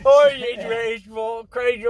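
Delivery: slow drawn-out British droid; British accent. Retold or imitated. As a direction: stretch it like a posh droid. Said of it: "his extra voice, mate".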